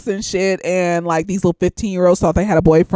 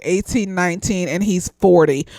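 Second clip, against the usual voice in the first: normal talking voice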